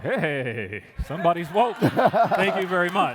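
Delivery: loud, high, drawn-out celebration; retold cheering. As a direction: hail an ally loudly, stretching it out.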